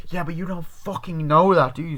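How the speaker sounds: high, loud mock challenge; acted angry retort. Friend challenges the girl defending him, mock angry retort. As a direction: fire back in pretend anger.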